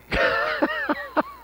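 squealing burst of laughter; high laugh. Real, not acted. Laugh at the story.

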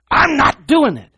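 high, loud, slow refusal to the flesh; firm self-rebuke. Retold or imitated. Minister scolding her own flesh, loud and slow. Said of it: "preacher voicing the friend's refusal".

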